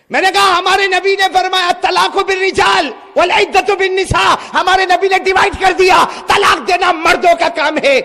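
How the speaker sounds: loud high proclamation; shouted preaching of the prophet's words; preaching at full force. Acted. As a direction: thunder out the hadith.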